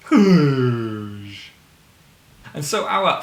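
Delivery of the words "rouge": Rouge drawn out loudly. Shown as a demonstration. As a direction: belt out a long Rouge. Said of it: "répétez".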